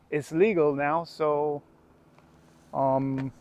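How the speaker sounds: dropping to a hushed, sheepish mumble; sheepish aside. Real, not acted. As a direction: let it fade like a confession. Trailing conspiratorial aside.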